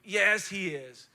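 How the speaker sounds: ordinary talk, nothing marked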